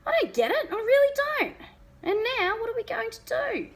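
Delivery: high, loud and frantic; panicked. A narrator's reading. Father at the gate, panicked and loud. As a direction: voice climbing in distress. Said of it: "He sounded really panicked".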